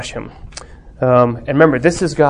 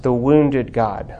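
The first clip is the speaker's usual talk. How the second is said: ordinary talk, nothing marked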